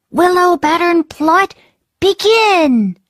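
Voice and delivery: excited call. Acted. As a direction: shout the start signal. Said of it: "he said".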